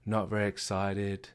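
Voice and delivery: soft, slow flat monotone; depressed monotone. Shown as a demonstration. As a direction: drag it out like a beaten-down person. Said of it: "really low voice, monotone voice".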